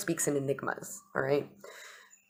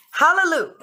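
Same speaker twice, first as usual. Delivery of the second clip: much higher and louder hallelujah; exultant cry; 13 semitones higher, 10 dB louder than usual. Real, not acted. Celebrating the wild card coming back, shouting. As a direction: shout it out in triumph.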